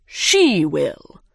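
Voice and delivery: low, loud bird voice; dodo voice. A narrator's reading. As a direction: declare it grandly. Dodo speaks.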